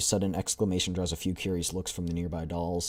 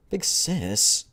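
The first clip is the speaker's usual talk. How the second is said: put-on pleading girl voice; 9 semitones higher, 7 dB louder than usual.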